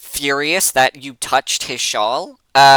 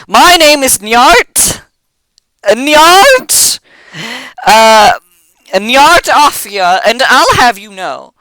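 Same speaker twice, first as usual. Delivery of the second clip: higher, louder, nervously stammering goblin; trembling goblin; 7 semitones higher, 3 dB louder than usual. Acted. Scared goblin introducing himself to his captor, trembling bluster. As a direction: bluster through fear as a shaky little noble. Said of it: "sweating a little bit and trembling and says".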